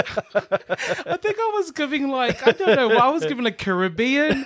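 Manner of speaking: high, squeaky laughing talk. Real, not acted. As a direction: squeal it through giggles, helpless at your own failed accent. Cracking up over failed accent.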